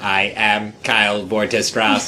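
low, slow, deliberate self-introduction; Kyle impression. Asked to do Kyle's voice again, low and slow. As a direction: announce yourself low and slow like a mock villain. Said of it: "do that voice again".

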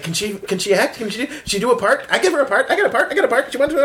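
high, rushed excited voice; imitating Chris. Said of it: "and he's like".

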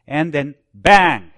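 slow pause then a loud punch on bang; drawn-out dramatic "bang". Acted. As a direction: let bang land hard and slow. Dramatic turn in sermon.

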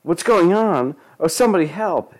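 mild scream. A narrator's reading. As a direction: call for help in a high panic. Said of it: "It was Lisa screaming".

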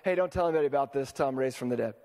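fast, offhand aside; rushed and casual, said on the way down; quick aside. Retold or imitated. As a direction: toss it off quickly to the side. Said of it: "he just says off to the side".

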